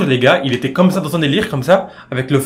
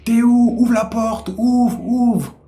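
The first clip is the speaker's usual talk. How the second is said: yelling through a door; 9 semitones higher, much slower than usual. Retold or imitated.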